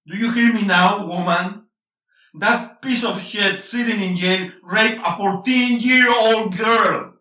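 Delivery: furious shout. Acted. Husband yelling at wife.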